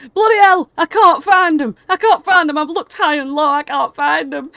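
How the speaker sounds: loud, high-pitched frantic cries; frantic panic. Acted. Father running round the corner searching, frantic panicked shouting. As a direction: cry out in rising desperation, voice high. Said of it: "absolutely shitting himself".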